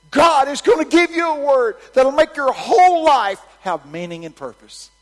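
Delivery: high, booming proclamation, easing at the very end; shouted prophecy. Retold or imitated. Preacher promising a granny a word from God, shouted prophecy. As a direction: declare it to the whole coliseum.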